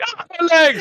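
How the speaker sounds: high, loud hammed-up yelp; teasing friend acting out her cry for help; mock cry of pain. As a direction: ham up a fall victim's cry. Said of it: "did you yell out for Dan".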